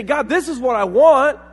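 loud, high insistent demand. Acted. Believer praying for own wish, loudly demanding. Imitated demand made to God.

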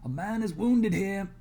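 loud, high, slow call for help. Acted. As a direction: shout for help across camp. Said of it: "I call".

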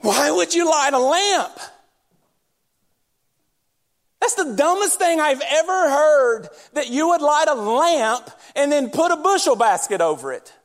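scoffing, disbelieving tone; sarcastic disbelief. Retold or imitated. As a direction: ridicule the idea openly. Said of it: "he said it just like this".

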